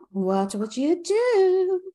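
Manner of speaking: high, drawn-out segment title; segment announcement. Acted. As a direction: stretch it out high, like a game-show tease. Announcing the podcast's recurring segment.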